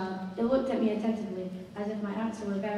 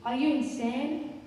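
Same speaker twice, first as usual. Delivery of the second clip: slow, raised, mildly angry question; 4 semitones higher, 5 dB louder, slower than usual. A narrator's reading. Narrator rebuffing the begging child, angrily. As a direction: draw it out in exasperated anger. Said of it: "I said angrily".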